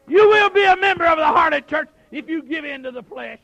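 high, loud pulpit shouting; full-force preaching. Acted. Preacher warning the church about sin, full-force preaching. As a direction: drive each phrase hard at the congregation.

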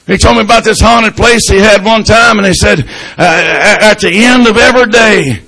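loud, high revival preaching cadence; preaching at full force. Acted. Preacher telling the congregation a ghost story, at full force.

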